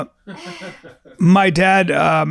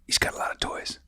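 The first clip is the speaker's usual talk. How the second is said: whispered aside; 10 dB quieter than usual. Real, not acted. Wry aside about rich man.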